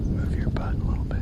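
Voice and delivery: urgent hushed whisper; wildlife watching whisper. Real, not acted. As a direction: whisper the order. Hunter repositions partner before shot.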